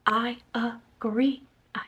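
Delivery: slow unvoiced whisper; whispered agreement. Real, not acted. Agreeing with the harsh review, slow whisper. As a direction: breathe it slowly, conspiratorial.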